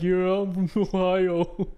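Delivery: slow, laughing dumb-guy voice; dopey reply. Retold or imitated. Friend imitates his dumb buddy, slow and laughing. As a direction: drag it out like a clueless tourist. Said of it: "He's like".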